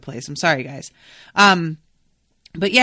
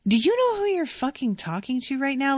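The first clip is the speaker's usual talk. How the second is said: higher-pitched, incredulous challenge; indignant rebuke; 7 semitones higher than usual. Retold or imitated. Viewer imagines confronting the patronizing man, indignant. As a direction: call him out in disbelief.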